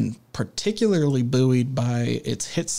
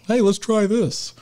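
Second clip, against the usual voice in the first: high-pitched imitated suggestion; offhand bright idea; 9 semitones higher than usual. Host voicing whoever recommended the accent, high mock suggestion.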